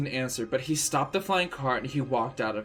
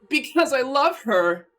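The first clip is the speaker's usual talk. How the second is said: much higher, loud desperate cry; shriek; 12 semitones higher, 6 dB louder than usual. Acted. Draco defends his love to the teachers, shrieking. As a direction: burst out with it. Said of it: "Draco shrieked".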